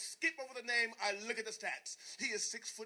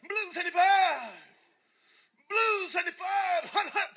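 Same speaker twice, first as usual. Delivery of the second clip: much higher and louder barked count; quarterback cadence; 10 semitones higher, 13 dB louder than usual. Retold or imitated. Quarterback calling signals at the line, a loud barked cadence.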